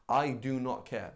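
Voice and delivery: slow, deliberate refusal to care; measured, emphatic indifference; callous attitude. Acted. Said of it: "That's their attitude".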